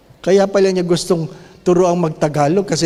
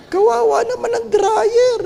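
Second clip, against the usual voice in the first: mock pity.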